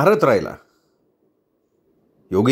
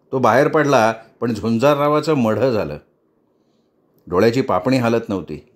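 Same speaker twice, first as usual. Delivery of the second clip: usual speaking voice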